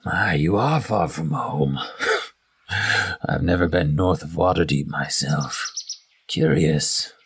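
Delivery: half-orc amused by the stranger's origin; half-orc, curious. Acted. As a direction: savour the words as the half-orc.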